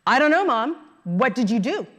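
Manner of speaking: a hint of a scowling seven-year-old; mother imitating her sulky young daughter.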